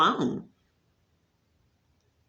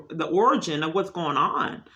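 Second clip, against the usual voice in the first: normal talking voice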